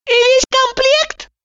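very high, slow chirpy voice; cheerful nurse. A narrator's reading. Piglet-faced nurse cheerfully asks the doctor. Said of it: "жизнерадостно поинтересовалась она".

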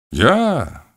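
a mock shout of surprise. A narrator's reading. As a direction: throw out a startled Ya. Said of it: "diye bağırdım".

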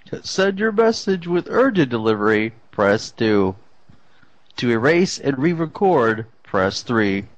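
automated prompt, press options dropping low; answering machine voice. Pretend. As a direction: recite menu like a robot system. Fake voicemail menu.

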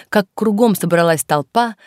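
ordinary delivery, nothing marked

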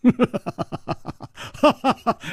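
high, rippling laugh; amused laugh. A narrator's reading. Chu laughs at the beaten old man, amused. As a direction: laugh at the tables being turned. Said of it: "楚留香笑着说".

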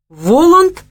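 high, loud cry of surprise; involuntary outburst, pitch leaping up; startled exclamation. A narrator's reading. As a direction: exclaim it before you can stop yourself. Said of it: "неожиданно для самого себя воскликнул Савелий".

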